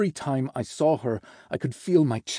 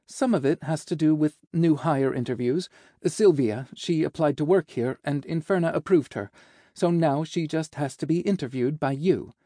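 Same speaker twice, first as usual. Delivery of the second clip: higher, businesslike assistant voice; cheerful secretary; 4 semitones higher than usual. Acted. Secretary explaining interviews to the boss, cheerful and efficient. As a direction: brightly fill the boss in.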